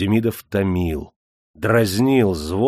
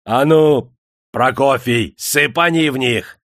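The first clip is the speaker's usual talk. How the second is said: громкий повелительный окрик; выше и громче, приказ; 4 semitones higher, 5 dB louder than usual. A narrator's reading. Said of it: "Никита крикнул". (Никита громко велит племяннику сыпать серебро)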